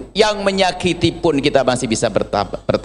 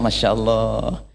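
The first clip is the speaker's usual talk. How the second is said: a meek wife softly praying for her wrongdoer; forgiving prayer; 5 semitones lower, 4 dB quieter than usual. Retold or imitated. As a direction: gently pray for the one who hurt her.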